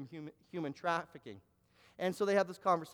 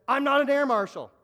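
louder and higher, stress on NOT; emphatic denial; 6 semitones higher, 9 dB louder than usual. Retold or imitated. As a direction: insist hard that it's wrong. Man flatly denies being air marshal.

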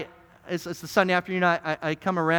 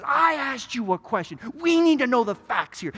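higher-pitched, mildly angry courtroom demand; angry lawyer; 5 semitones higher than usual. Acted. Defense lawyer losing patience with old man on the stand, angry. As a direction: press the witness, voice raised in pitch. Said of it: "the lawyer gets really angry".

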